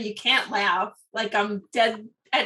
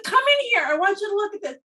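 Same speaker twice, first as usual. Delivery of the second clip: eager invitation; 9 semitones higher, 3 dB louder, faster than usual. Retold or imitated. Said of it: "He's like".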